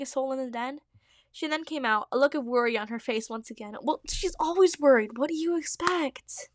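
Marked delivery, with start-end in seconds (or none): none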